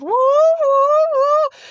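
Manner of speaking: high, loud siren wail, woo woo woo; imitated alarm wail. Imitating the alarm that went off during the hit, loud siren whoop. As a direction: mimic a shrill alarm going off. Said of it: "this alarm goes off".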